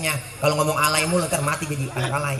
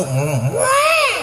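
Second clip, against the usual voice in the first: sudden much higher yelp; comic shout. Retold or imitated. Preacher acts grandma startled by the noise, high yelp.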